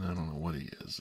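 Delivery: ordinary delivery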